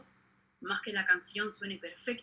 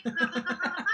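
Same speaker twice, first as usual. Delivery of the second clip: bursts of genuine giggling; quick high giggles; real laughter; 8 semitones higher than usual. Real, not acted. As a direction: laugh it out freely. Laughing at own euphoria.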